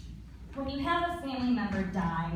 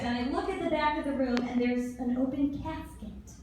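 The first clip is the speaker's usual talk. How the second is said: ordinary delivery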